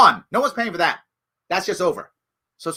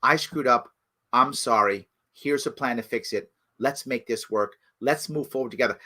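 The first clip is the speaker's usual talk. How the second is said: low, soft sincere admission; simple apology; 11 semitones lower, 6 dB quieter than usual. Retold or imitated. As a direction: voice a leader owning his mistake. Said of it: "How about a simple".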